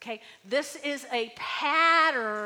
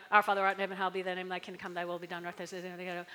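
fast, low, mechanical recital; rattled-off prayer; 5 semitones lower, much faster than usual. Shown as a demonstration. Pastor showing a prayer said by rote, rushed. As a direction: gabble it by rote. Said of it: "someone comes up to you".

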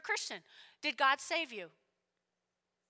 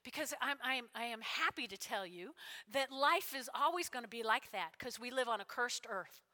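normal talking voice

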